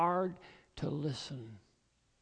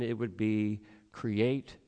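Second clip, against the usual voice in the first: hushed reading; 7 semitones lower, slower than usual. Retold or imitated.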